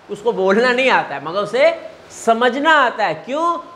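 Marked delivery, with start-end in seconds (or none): none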